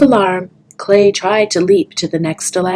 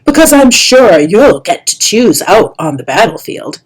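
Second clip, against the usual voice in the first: sarcastic mockery; 3 semitones higher, 8 dB louder than usual. Kestrel mocking Clay's wish.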